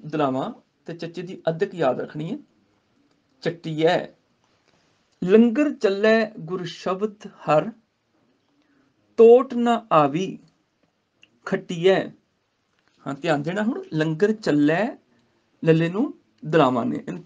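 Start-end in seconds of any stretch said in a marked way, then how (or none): 5.2-12.1 slow measured Gurbani recitation. Shown as a demonstration. Teacher recites the verse to students, slow. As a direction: chant each word with pauses.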